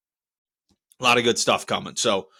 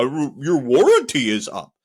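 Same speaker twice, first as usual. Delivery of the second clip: mocking the agent; slower than usual. Mocking the support agent's words.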